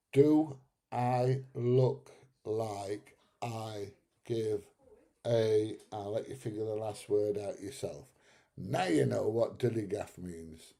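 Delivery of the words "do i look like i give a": drawn out very slowly, deadpan; slow demonstration. Shown as a demonstration. Spelling out the meaning for viewers, very slow. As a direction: stretch every word out for effect. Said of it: "Are you ready?".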